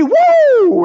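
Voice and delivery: high, loud woo of delight; joyful whoop. Real, not acted. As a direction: whoop for joy. Excited over Jesus' power.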